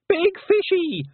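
put-on very high toddler yell; loud small-child shout; Wentworth yelling. A narrator's reading. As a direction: squeal it like an excited little boy. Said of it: "yelled Wentworth".

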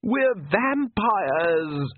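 high, drawn-out exasperated cry; slow, high-pitched indignant wail; shouted. A narrator's reading. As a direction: stretch the word out in outrage. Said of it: "Lacrimosa shouted".

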